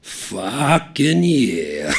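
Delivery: high, loud splutter into laughter; laughing agreement, spluttering. A narrator's reading. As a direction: burst out laughing mid-word. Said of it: "splutters Taihoa".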